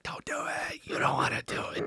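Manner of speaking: whispered inner voice pleading don't; inner voice warning. Retold or imitated. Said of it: "every cell in my body was like".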